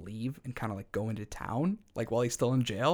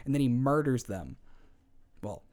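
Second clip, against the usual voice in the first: ordinary talk, nothing marked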